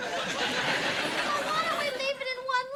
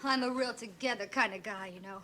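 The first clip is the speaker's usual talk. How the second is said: low swaggering guy voice; imitating Jack; 11 semitones lower than usual. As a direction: brag in a lowered dude voice. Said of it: "Why don't you be Jack and show us?".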